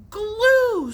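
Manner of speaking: sudden high scream. A narrator's reading. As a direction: shriek it out in outrage. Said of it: "screamed Billy all of a sudden".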